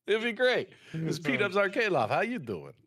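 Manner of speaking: high, loud channel-host greeting; hammed-up announcer hello, starting high; voiceover intro. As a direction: pitch it like a big show opener. Demonstrates the proposed voiceover intro.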